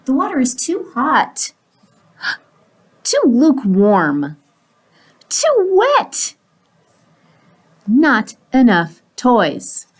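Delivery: whiny complaints, jumping up high; fussy pigeon. Acted. Pigeon testing his bath water, fussy complaining. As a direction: pick fault with everything, drawing words out.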